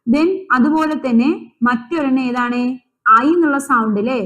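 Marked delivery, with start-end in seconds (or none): none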